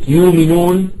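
"يؤمنون" with a long "oo" and no glottal stop; smooth yooominoon for "يؤمنون"; hamza replaced. Shown as a demonstration. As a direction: lengthen the first vowel of "يؤمنون" in place of the hamza. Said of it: "الإبدال مثل كلمة".

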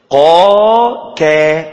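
very long sung قا from far back, then كا further forward; qaf against kaf. Shown as a demonstration. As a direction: draw out قا, then place كا nearer the front. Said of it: "بعيد خالص في آخر اللسان".

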